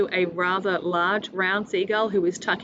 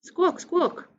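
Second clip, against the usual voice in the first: high gull cry; seagull squawk; 10 semitones higher than usual. Acted. One-legged seagull eyes the bread, squawking high.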